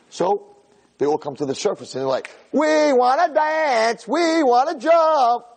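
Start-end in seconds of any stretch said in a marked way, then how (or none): 2.5-5.5 high, loud, drawn-out party chant; excited fish. Acted. Fish wanting to party at the surface, giddy high shouting. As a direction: squeal it slowly like giddy fish.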